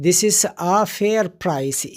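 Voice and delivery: "this is a fair price" said very slowly, word by word; slow example sentence. Shown as a demonstration. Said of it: "یعنی ہم کہتے ہیں". ("this is a fair price" کو بہت آہستہ، ایک ایک لفظ الگ کر کے بولیں)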